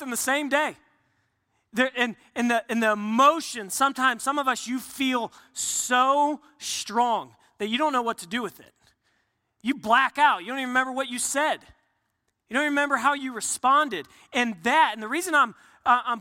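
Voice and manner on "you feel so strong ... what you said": ordinary talk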